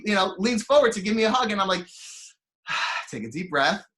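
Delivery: ordinary delivery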